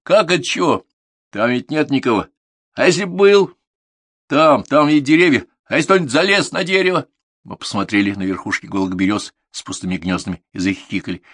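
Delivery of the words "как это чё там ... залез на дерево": high, loud scolding questions; indignant instructor; exasperated rebuke. A narrator's reading. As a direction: make the pupil see the danger. Instructor rebukes the protesting pupil.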